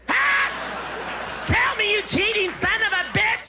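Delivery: high, yelling accusation at a cheater; jealous shriek. Acted. Wife screaming at her cheating husband, furious. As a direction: scream it at him like a betrayed wife.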